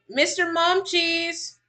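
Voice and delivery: high, drawn-out singsong call. Real, not acted. Parent calling Mister Mumchies mid-stream, calling out. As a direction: call the pet name slowly and high. Calls out to the child.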